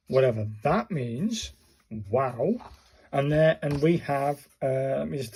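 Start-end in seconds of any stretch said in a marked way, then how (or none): none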